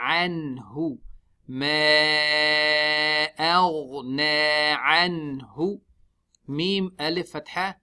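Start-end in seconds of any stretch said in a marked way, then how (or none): 0.1-1.0 Anhu with smiling an and lips rounded on hu. Shown as a demonstration. Teacher showing the dhamma on Anhu, lips rounded. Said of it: "we make the lips round". 1.5-5.8 slow, louder chanted tajweed recitation; Quran recitation. Shown as a demonstration. Teacher recites the ayah for the class, slow chant.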